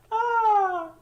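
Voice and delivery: a loud high shriek of laughter; high laughing shriek. Real, not acted. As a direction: let out a shrill cry of delight. Still laughing at article.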